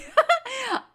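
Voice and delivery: short high-pitched laugh; amused laugh. Real, not acted. Reactor amused at own remark on nineties fame, amused laugh. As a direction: burst out in a quick high laugh.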